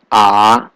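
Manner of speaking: A going down, then up; third tone. Shown as a demonstration. Teacher explaining the third tone, dipping A. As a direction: dip A low and bring it back up. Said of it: "Third tone is going down".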